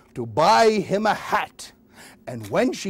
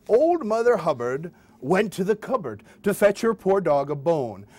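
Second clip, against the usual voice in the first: usual speaking voice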